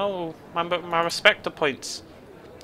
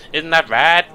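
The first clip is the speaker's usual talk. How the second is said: louder, strange-sounding question; bizarre voice; 2 semitones higher, 6 dB louder, slower than usual. Acted. Grit Mitten turns to the player in an odd voice. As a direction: ask it in an odd put-on voice. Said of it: "in a bizarre voice".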